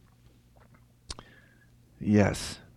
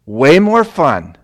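loud, slow and higher; emphatic; 4 semitones higher, 11 dB louder, slower than usual. Real, not acted. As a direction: punch it out to the church. Preacher emphasizing.

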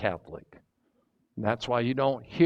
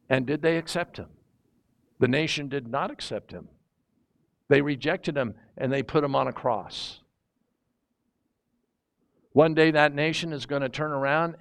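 ordinary talk, nothing marked

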